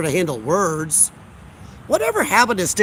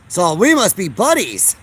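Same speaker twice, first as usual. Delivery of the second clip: usual speaking voice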